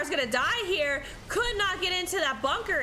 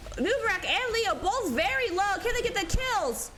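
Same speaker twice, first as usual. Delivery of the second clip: pitched-up tense call; excited play-by-play; 6 semitones higher than usual. Real, not acted. Caster calling a race to the kill, tense and excited. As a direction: ride the suspense of who dies. Both heroes near death.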